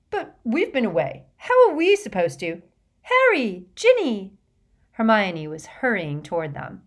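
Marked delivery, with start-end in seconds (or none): 0.1-2.6 agitated protest. A narrator's reading. Ron locked out, protesting. 3.0-4.4 calling out. A narrator's reading. Hermione calls friends while hurrying over.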